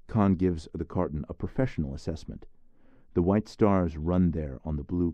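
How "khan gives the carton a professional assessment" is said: ordinary delivery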